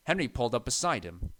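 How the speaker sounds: ordinary delivery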